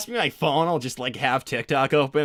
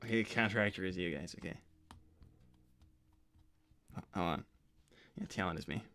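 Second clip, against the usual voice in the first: low, soft self-talk; reading to oneself; 8 semitones lower, 14 dB quieter, faster than usual. Acted. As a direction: work through the text half aloud, very quietly.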